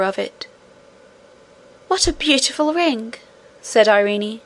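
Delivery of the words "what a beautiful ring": put-on delighted girl. Irene admires the ring.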